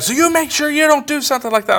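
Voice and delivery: high, rapid scolding without real volume; mock boss yelling. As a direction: rattle off a high-pitched imitation of a telling-off. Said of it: "And she's yelling".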